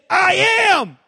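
high, loud defiant yell; teen shout. Retold or imitated. Son yelling to dad from his game, high and loud. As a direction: shout it back without looking up.

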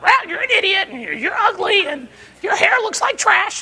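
high, squawky put-on parrot voice; insulting parrot. Parrot insulting its owner, squawky mock voice. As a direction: squawk the insults like a rude bird.

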